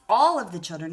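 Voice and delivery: put-on ALL of the children said loud; stress on all. Shown as a demonstration. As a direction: lean hard on all in all of the children. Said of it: "I should put a comma there".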